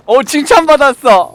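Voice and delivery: 들떠서 큰 소리로; 신나서 자랑하듯; excited. Real, not acted. 칭찬받고 들뜸. (신이 나서 외치는 말투)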